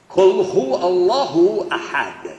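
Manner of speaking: Quran recitation. Acted. Quoting Quran verse in Arabic.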